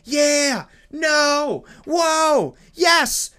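put-on high-pitched kid exclamations; each word a high, falling gasp of wonder; amazed kid. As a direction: imitate a child wowed by a movie. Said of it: "So the kid needs to be like".

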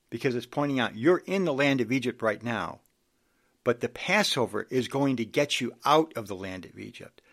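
normal talking voice

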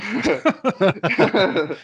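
high burst of real laughter. Real, not acted. Strongman laughs off a question about his back. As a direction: laughs out loud at the chin-up question.

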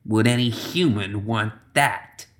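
soft raspy witch voice; quiet rasp. Acted. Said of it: "she rasped quietly".

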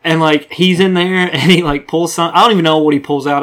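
usual speaking voice

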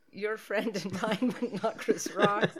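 barely holding back laughter, slowly; laughing running gag. Shown as a demonstration. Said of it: "That's what I'm gonna say".

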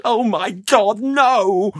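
very high, loud wail of despair; sobbing. Acted. Youth pleads in terror of torture, sobbing. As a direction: cry out in desperate horror. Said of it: "I sobbed".

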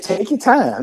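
coaxing patiently. Retold or imitated. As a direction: urge her to take it easy. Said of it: "She said".

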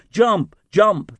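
two high, punched calls; urgent shouting. A narrator's reading. Crew call to the boy left on deck, urgent shouts. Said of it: "they shouted".

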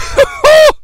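high loud cackle; drunk cackle. Retold or imitated. Drunk laughing fit imitation.

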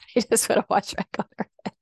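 amused, chuckling delivery; laughing. Real, not acted. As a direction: speak it while laughing at how cute it was. Amused retelling of cute video.

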